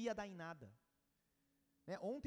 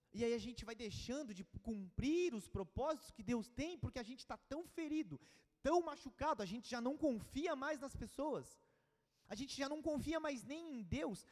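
rising, louder and higher sermon climax; impassioned preaching; 6 semitones higher, 5 dB louder than usual. Acted. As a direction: preach it out, building passion. Preaching at full force.

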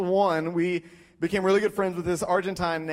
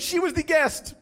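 much higher, insistent repeat; earnest insistence; 9 semitones higher than usual. Retold or imitated. Friend repeating his odd explanation, earnestly insistent. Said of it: "He's like".

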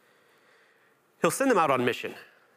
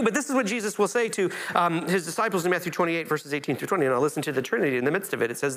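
ordinary delivery

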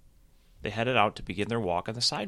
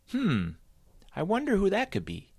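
lightly voiced cheerful bunny, higher; a bright, bouncy bunny voice; a hint of a chirpy cartoon bunny; Happy Sam; 12 semitones higher than usual. A narrator's reading.

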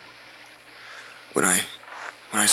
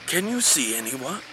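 usual speaking voice

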